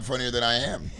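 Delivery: ordinary delivery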